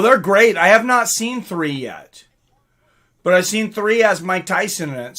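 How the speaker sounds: ordinary delivery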